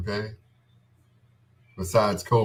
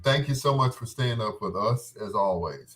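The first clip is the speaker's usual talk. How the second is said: ordinary delivery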